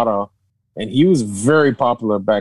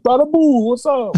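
crowd hailing; 13 semitones higher than usual. Retold or imitated. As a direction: call out high like a delighted onlooker. Crowd hailing the costumed kid.

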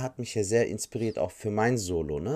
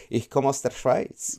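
put-on Swiss accent; 3 semitones higher, slower than usual. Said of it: "meldet sich der eine Schweizer".

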